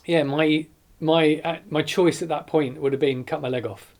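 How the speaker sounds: fighting back tears while recounting; emotional. Real, not acted. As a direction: voice rising with held-back emotion. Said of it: "I don't know why I'm getting emotional".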